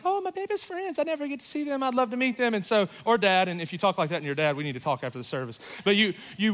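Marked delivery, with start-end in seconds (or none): 0.1-2.5 much higher, sing-song proud mom voice; gushing mom. Retold or imitated. Proud mom at the mall, gushing high over the friends. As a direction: coo like a doting mother. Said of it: "It's like".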